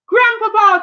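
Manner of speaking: high mock dramatic cry.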